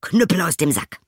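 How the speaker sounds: hoher, lauter Befehlsruf; Ruf. A narrator's reading. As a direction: den Knüppel laut herausrufen. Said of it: "rief er". (lauter Zauberruf)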